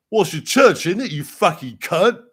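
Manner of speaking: high, loud, outraged commoner's shout; angry churchgoer. Acted. Mourner swearing back at the official, shrieked outrage.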